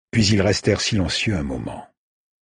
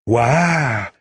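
a short high "Ouah"; exclaiming; 5 semitones higher, 4 dB louder than usual. A narrator's reading. Said seeing Wiley's wound, exclaiming in wonder. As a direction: gasp in wonder. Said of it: "s'exclama Saïd".

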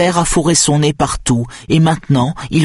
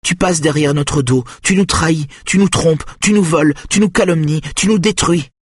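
reproches furieux; 9 semitones higher than usual. A narrator's reading. Mother's angry accusations at the boy.

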